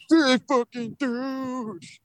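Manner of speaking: high, drawn-out mocking whine; high-pitched scoffing imitation; mock outrage. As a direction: mimic the outraged crowd, stretched out and high. Mocking everyone's outraged reaction to smashing.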